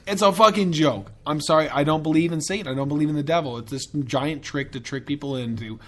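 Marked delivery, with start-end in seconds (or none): none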